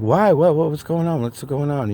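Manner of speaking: mildly alarmed mock confusion; baffled questioning. Retelling his confused questions to girlfriend.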